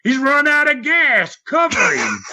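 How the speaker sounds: high-pitched mock scream of an order; Dick Murdoch. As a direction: imitate an urgent yell without full volume. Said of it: "Dick Murdoch screams at me".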